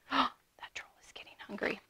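gasp, then hushed whisper; whispered aside. Real, not acted. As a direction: whisper it like an alarming secret. Hushed aside to the young listeners.

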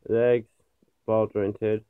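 ordinary talk

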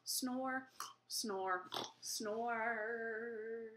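soft, drawn-out snores; pretend sleeping. Singer puts the llamas to sleep, pretend sleeping. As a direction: say it softly between pretend snores. Llamas fall asleep at song's end.

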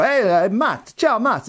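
high, lilting mock Italian accent. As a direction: greet Matt like a cheerful Italian friend, Italian accent. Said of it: "I was gonna do an Italian accent".

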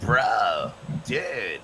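laid-back American dude voice, drawn up high on dude; lightly done American bro accent; American surfer accent. Shown as a demonstration. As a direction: model a chill American bro. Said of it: "Let's be like".

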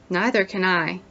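plain reading voice

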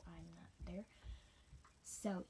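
usual speaking voice

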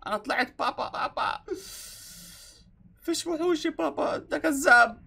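put-on high, squeaky kid voice calling dad a liar; child voice. The boy tells his dad there are no monsters, high kid voice. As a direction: pitch way up like a small boy.

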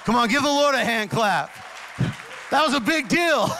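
loud, high cheer to the crowd; rallying shout. Real, not acted. Pastor urging the church to clap, loud high shout. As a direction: whip the congregation into applause.

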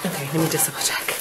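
quick whisper; whispered aside. Real, not acted. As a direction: whisper it fast, as if to yourself. Aside while checking the gift.

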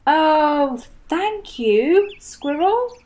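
delighted relief; grateful Rabbit. Acted. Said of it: "said Rabbit".

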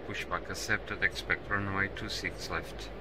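normal talking voice

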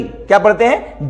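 ordinary talk, nothing marked

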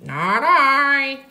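high, drawn-out pig voice; storybook pig. A narrator's reading. Pig refuses the trip to the mill, high voice. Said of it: "said the pig".

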